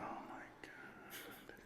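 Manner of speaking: breathless slow whisper; whispered dread. Real, not acted. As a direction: barely breathe it out. Text about mess upstairs.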